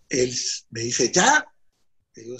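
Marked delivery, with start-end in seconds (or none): 1.1-1.5 surprised question. Retold or imitated. Friend reacts to surprising news.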